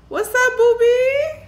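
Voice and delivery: high squealed hello; excited greeting. Real, not acted. Squealing greeting to chat member.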